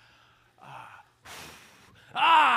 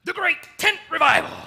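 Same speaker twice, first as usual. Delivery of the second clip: proud shout; 11 semitones higher, 14 dB louder than usual. Acted. Proudly proclaiming the event.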